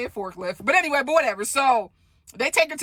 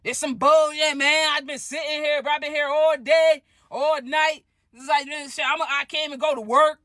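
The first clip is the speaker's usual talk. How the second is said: loud, raised griping in the waiting line; loud complaining; 6 semitones higher than usual. Retold or imitated. Stud griping loudly while waiting for discharge, raised voice. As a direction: complain so the whole hallway hears. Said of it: "man, loud".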